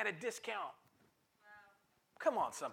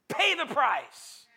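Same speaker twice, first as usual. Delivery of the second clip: louder, slow Pay the price; slow emphatic refrain; 4 semitones higher, 6 dB louder, much slower than usual. Acted. Preacher driving home the cost, slow and loud. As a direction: lean on every word, slow.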